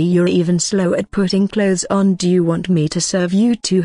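plain reading voice

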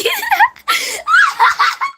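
high laughing scream; shrieking laughter. Real, not acted. (비명 섞인 웃음)

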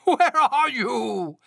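high, loud, long drawn-out call; wailing cry. A narrator's reading. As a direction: holler for a lost friend. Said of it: "cried the thing".

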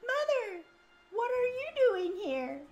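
put-on falsetto young girl; high-pitched girl voice. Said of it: "Sharita's, like, very high-pitched too".

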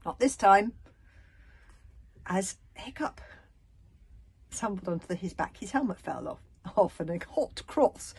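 usual speaking voice

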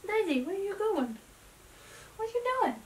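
put-on high sing-song baby talk; dog mom voice. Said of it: "my dog mom voice".

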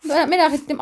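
usual speaking voice

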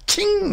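a high, sharp mock ricochet ting; the ping of a stray bullet through glass. Telling how a bullet came through their window, a high mock ping.

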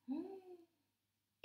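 high, surprised gasp. A narrator's reading. Cat and squirrel gasping at the light. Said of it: "they shrieked".

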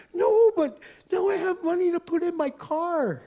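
very high, loud, hammed-up gushing; overwhelmed gratitude. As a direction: imitate a stranger shrieking thanks. Retold grateful stranger gushing loudly.

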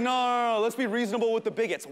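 loud mocking imitation; mocking dad. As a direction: mimic dad's reasonableness sarcastically. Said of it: "Now you're like".

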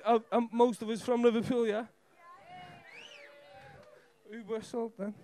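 usual speaking voice

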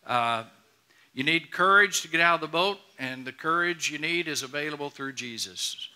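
usual speaking voice

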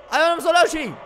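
high, loud startled shout; surprised shout. Real, not acted. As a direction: yell it as they pop up.

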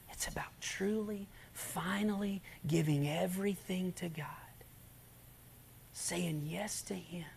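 slow hushed sermon whisper; intimate whisper preaching. Acted. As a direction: draw the listeners in with a whisper.

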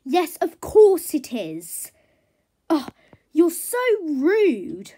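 loud, drawn-out indignation; haughty offence. Acted. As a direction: stretch it out, highly affronted. Haughty horse offended by remark.

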